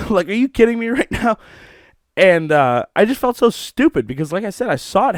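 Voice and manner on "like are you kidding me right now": high-pitched mock exasperation; retold sarcastic comeback with a laugh in it; incredulous retort. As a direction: throw it back in disbelief, pitched up. Retold incredulous reply to wife.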